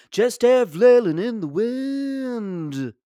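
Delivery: high, slow nasal-free folk drawl sung-spoken like Dylan; [name] impression. Comic imitating [name] singing his own life. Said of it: "That sounds like a [name] song".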